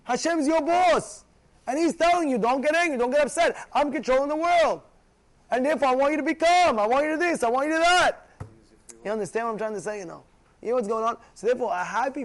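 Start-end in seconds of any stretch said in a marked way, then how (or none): 0.0-8.3 raised, high insistent preaching voice; commanding, higher-pitched exhortation; emphatic divine command. Acted. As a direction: lay down the law forcefully. Acts God's command, preaching forcefully.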